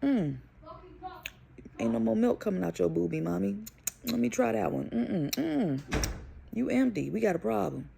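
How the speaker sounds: put-on child's voice. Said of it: "Isaiah decides".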